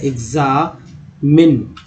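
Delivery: एग्जामिन said slowly, with the x as gz; slow demo word. Shown as a demonstration. Teacher shows learners the x sounding as gz, slowly. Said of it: "कभी कभी ज भी हो जाता है".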